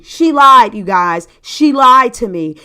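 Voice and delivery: LIED hit hard and loud; slow, punched indignation; indignant emphasis. Real, not acted. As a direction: land on lied with real outrage. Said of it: "totally slammed me".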